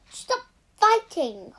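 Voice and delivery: loud, high shout to end the fight; angry shout. Acted. Mother silencing the quarrelling family, loud shout. Mother yells at arguing children.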